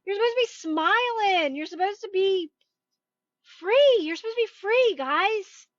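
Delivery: joyful cry of freedom; rising excitement. Real, not acted. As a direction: build up and explode on free. Building excited exhortation.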